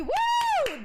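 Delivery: high, loud cheer; excited woo. Real, not acted. Streamer cheering a subscriber, loud excited whoop. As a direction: whoop for the new sub.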